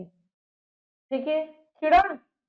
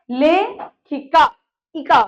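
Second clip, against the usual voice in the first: slow teaching demo; slower than usual. Shown as a demonstration. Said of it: "अक की ध्वनि आ रही है".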